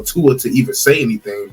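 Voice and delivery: usual speaking voice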